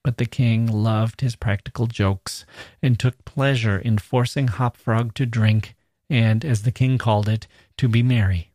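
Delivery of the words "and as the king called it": usual speaking voice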